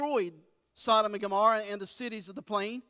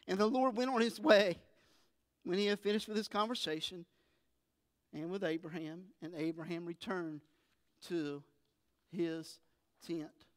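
fading story close; 3 semitones lower, 5 dB quieter than usual. Acted. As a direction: fade down to a hush as the story ends. Reading closes, fading away.

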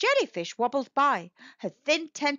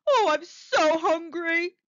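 hungry shark; 6 semitones higher, 5 dB louder than usual. Acted. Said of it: "he said".

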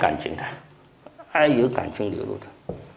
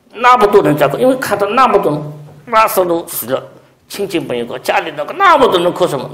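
preaching; 8 semitones higher, 7 dB louder than usual. Acted. Preaching at full force.

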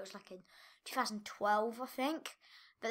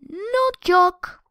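loud emphatic insistence; loud, firm "not joke"; 7 semitones higher, 15 dB louder than usual. Real, not acted. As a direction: insist it loudly. Insisting to viewers.

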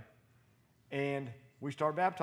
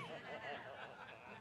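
breathy laugh; 2 semitones lower, 14 dB quieter than usual. Real, not acted. Laugh after joke.

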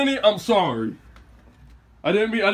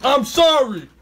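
calling out; 6 semitones higher, slower than usual. Real, not acted. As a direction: call her name across the house. Grandson calling out to granny.

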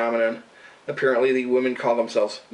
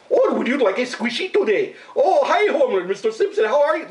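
high, laughing mock Indian accent like Apu; Apu impression; 10 semitones higher than usual. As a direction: ham up a cartoon Indian shopkeeper accent, giggling. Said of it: "think Apu from The Simpsons".

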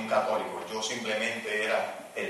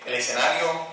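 ordinary talk

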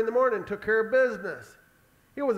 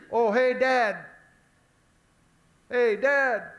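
lightly acted kid, pitched up; boy calling Dad; 4 semitones higher, 3 dB louder, slower than usual. Isaac calling to his father on the way, lightly boyish call. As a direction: call out for a parent's attention.

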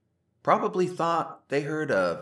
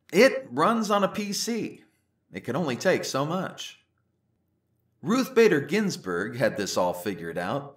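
ordinary delivery

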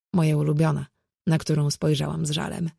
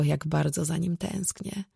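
ordinary delivery, nothing marked

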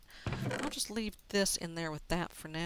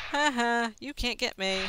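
louder mocking laugh and taunt; 4 semitones higher, 7 dB louder than usual. Real, not acted. Player gloating at a mob that can't reach him, taunt. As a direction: gloat at the enemy.

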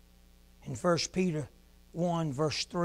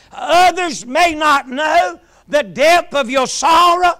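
loud, ringing sermon climax; preaching at full force; 7 semitones higher, 13 dB louder than usual. Acted. Preacher lifting the congregation to a shout. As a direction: preach it out to the whole church.

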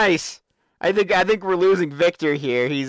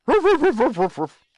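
mock barking; 7 semitones higher than usual. Imitating a dog for puppies.

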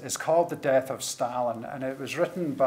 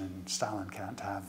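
conspiratorial whisper; 5 semitones lower, 9 dB quieter than usual. Real, not acted. Narrating a dangerous secret.

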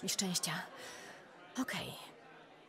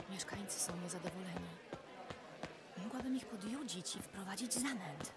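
whispering; 7 dB quieter than usual. Acted. Sneaking past security, whispering.

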